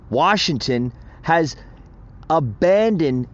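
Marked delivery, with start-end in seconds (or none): none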